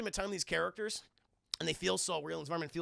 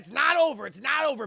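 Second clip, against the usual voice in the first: loud, higher-pitched announcement; punchy, raised storyteller's warning; excited hype; 5 semitones higher, 11 dB louder than usual. Real, not acted. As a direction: hype the twist to your audience. Hypes the next twist.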